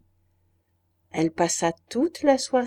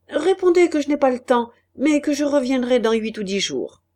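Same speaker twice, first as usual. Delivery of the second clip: raised, louder businesslike reply; brisk dismissal; 5 semitones higher, 5 dB louder than usual. A narrator's reading. Busy banker answering a waiter's message, brisk and firm. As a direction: send the messenger off with a firm answer.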